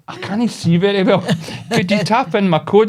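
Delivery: high old-lady impression, asking for help; mock helpless old lady; wee old woman. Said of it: "this wee old woman".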